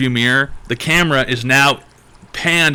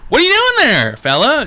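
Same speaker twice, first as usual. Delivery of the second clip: much higher, louder folksy call from a car window; friendly dad calling out; 13 semitones higher, 4 dB louder than usual. Pretend. Family dad calls to a junkie from his SUV, chirpy folksy voice. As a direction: play the cheerful dad hailing a stranger.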